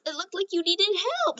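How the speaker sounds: high, whiny put-on cry; mock tearful. Deku to Bakugo after catching him, mock tearful. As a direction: pretend to be near tears. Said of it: "Starts crying like that".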